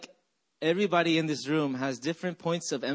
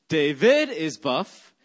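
"David" with VID punched loud and stretched; slow phrase, hit VID in "David"; stress on second syllable; 6 dB louder, slower than usual. Shown as a demonstration. Pastor showing congregation the syllable game, stress on VID of "David". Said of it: "you emphasize every syllable".

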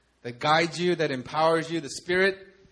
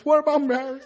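higher, hammed-up movie hero voice; George Bailey impression; 8 semitones higher, faster than usual. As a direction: play up his way of talking for laughs. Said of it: "He talks like this".

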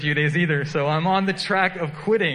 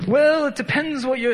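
high, louder, badly faked Italian accent; botched Italian accent; 10 semitones higher, 4 dB louder than usual. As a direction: try and fail at an Italian accent, pitched way up. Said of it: "how to do an Italian accent".

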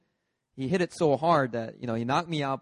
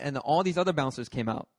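ordinary delivery

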